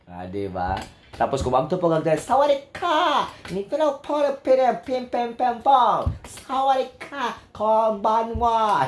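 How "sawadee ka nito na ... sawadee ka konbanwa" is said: high, slow sing-song Thai greeting; mock Thai accent. Host imitating Thai people greeting, mock Thai accent. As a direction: stretch it in exaggerated Thai intonation. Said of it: "mana mga taga-Thailand".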